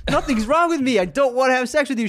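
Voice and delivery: acting out an exasperated refusal; exasperated retort. Acted quarrel, refusing his date.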